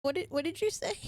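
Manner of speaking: put-on high, hesitant child voice; confused little boy. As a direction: stammer it as a puzzled small boy.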